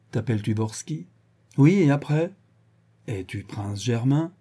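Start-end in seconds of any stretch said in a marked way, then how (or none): 1.6-2.3 higher, impatient retort. Acted. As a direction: answer testily, pushing him on. Vorski exasperated by the questioning. (impatience agacée)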